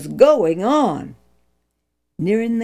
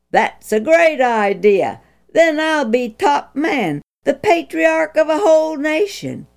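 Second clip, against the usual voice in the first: higher, smug and self-important; proud boastful man; 8 semitones higher, 4 dB louder than usual. Acted. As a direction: puff up like a proud leader. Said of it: "If Moses had been a proud man".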